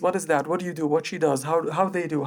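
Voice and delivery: ordinary delivery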